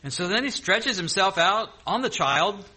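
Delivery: ordinary talk, nothing marked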